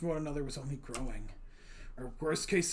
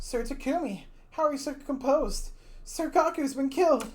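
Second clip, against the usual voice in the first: loud, high, distressed disbelief; upset outburst; 11 semitones higher, 8 dB louder than usual. Acted. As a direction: cry it out in shock. Character upset at composure.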